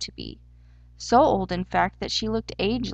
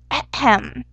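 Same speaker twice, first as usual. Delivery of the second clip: loud, high "ahem"; declamatory throat-clearing; 4 semitones higher, 5 dB louder than usual. A narrator's reading. Hare about to declare a riddle, clearing his throat. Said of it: "Then he declared".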